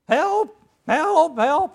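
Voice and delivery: high, loud calls for help; cry for help. Retold or imitated. Scarecrow calling out for rescue, high and loud. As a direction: shout help as if trapped.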